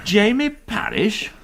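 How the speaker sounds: who on earth is this; baffled query. Acted. Reading the daily report, baffled.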